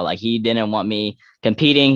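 usual speaking voice

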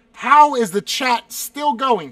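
loud, slow, high-pitched complaint; exasperated disbelief. Real, not acted. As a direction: drag it out loudly in disbelief. Amazed the question flood continues.

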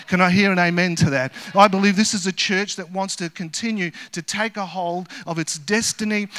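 loud, ringing preacher's call to the church; rally the congregation like a revival preacher; full-force preaching. Acted. Pastor fires up the church about its future, loud preaching.

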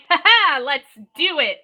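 mock glee. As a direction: act out over-eager glee. Mocks the idea of eager glee.

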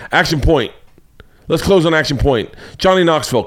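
usual speaking voice

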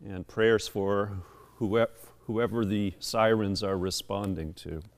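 low, subdued pastoral prayer. Real, not acted. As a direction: pray aloud for the congregation, quieting down. Leads the congregation in prayer.

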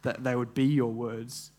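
quiet reverent prayer, unhurried. Real, not acted. Opens in prayer.

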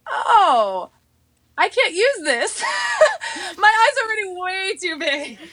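high, laughing mock protest; laughing quote. Telling a friend about the makeup gift, laughing high. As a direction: squeal it through laughter. Retold reaction to eyelid gift.

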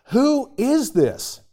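high, slow, wondering "who is this?"; wondering question. Retold or imitated. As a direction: ask it slowly, high, amazed like the stirred city. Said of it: "the whole city was stirred and asked".

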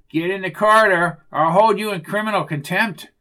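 loud self-important command; officious threat. Acted. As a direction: threaten them with your authority, loudly.

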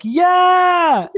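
high, loud mock cheer; everybody cheering at once, loud and high; crowd cheer. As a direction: belt out a cheering yell like the whole crowd. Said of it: "everybody's like".

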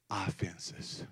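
breathy hush on "Offenses."; dramatic whisper; whispered point. Acted. Giving the point to write down.

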